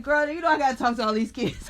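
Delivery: a playful teacher laughing her student off; excited retort. Retold or imitated. Teacher answering her eager pupil, fast and excited. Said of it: "I'm like".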